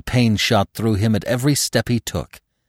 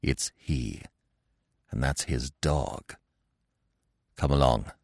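hushed; 6 semitones lower, 8 dB quieter than usual. A narrator's reading. Hushed warning, hunter near.

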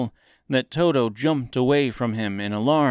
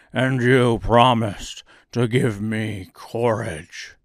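slow, heavy lion voice; cowardly lion; 4 dB louder, slower than usual. A narrator's reading. Lion reminding Oz of his promise, slow lion voice. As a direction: draw it out slowly as a big lion.

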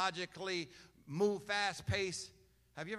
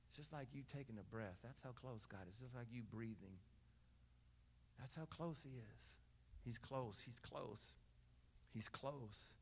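hushed intimate preaching; 6 semitones lower, 15 dB quieter than usual. Acted. Pastor hushing the church to feel God near, softly. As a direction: draw them close with a hushed voice.

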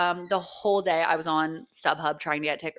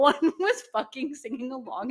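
ordinary delivery

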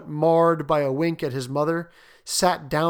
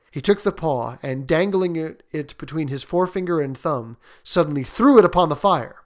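ordinary delivery

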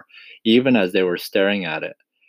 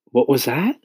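higher voice, rising in alarm; quick, startled question; 5 semitones higher than usual. A narrator's reading. James hearing something, asking quickly. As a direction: break off and ask sharply. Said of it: "he asked quickly".

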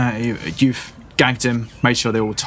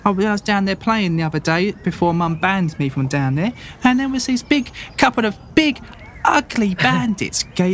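put-on high, excited little boy boasting; a kid bragging to adventurers; eager farm boy; 7 semitones higher than usual. GM keeps voicing boy.

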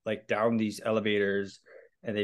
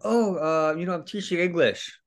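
nervous excuse; 6 semitones higher than usual. Retold or imitated. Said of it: "super nervous".

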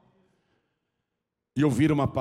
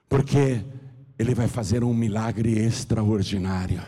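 usual speaking voice

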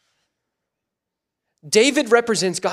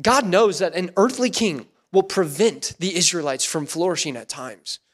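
usual speaking voice

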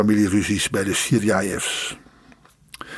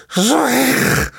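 high yell, much higher; 12 semitones higher than usual. Acted. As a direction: stamp and roar at the son. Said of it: "riep zijn vader en hij stampvoette".

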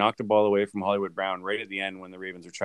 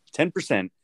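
"ten" jumps much higher, falling on "percent"; emphatic repeat; 7 semitones higher than usual. Real, not acted. Host stressing the playoff odds to co-host, emphatic. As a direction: hit "ten" high in disbelief.

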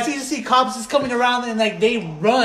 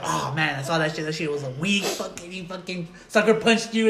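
lightly voiced bouncer, lower and softer; sympathetic bouncer; 5 semitones lower, 6 dB quieter than usual. Bouncer consoling the punched customer, low-key retold sympathy. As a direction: confide sympathy in a low, quiet voice. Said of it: "the bouncer was like".

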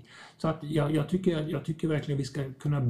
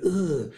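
high, louder ugh of aversion; disgusted grunt; 5 semitones higher, 3 dB louder than usual. Retold or imitated. The host imitates a shame reaction, a short loud ugh. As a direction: blurt a short grossed-out groan.

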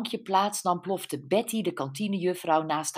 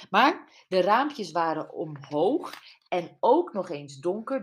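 usual speaking voice

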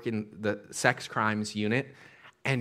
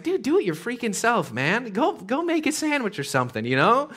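high, fed-up retort; exasperated; 10 semitones higher than usual. Retold or imitated. Preacher scolding the son, exasperated. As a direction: scoff at the lazy son. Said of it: "In my mind, it's like".

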